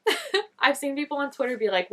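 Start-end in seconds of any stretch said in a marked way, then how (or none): 0.1-0.4 squealed okay. Retold or imitated. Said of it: "leaning in, like".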